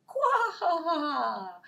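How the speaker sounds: loud croak with laugh. Acted. Frog greeting the animals with a croak. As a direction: croak playfully.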